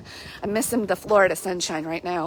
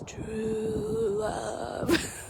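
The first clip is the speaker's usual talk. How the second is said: much higher and softer, voice changed; hammed-up mostly-dead "True love."; feeble dying Westley; 12 semitones higher, 8 dB quieter than usual. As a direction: gasp it out as if nearly dead. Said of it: "And he goes".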